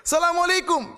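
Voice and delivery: a high, loud deliberate greeting; loud shout. Retold or imitated. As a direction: shout the salam to the statue on purpose. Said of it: "saya sengaja keras". (salam diteriakkan keras dan tinggi)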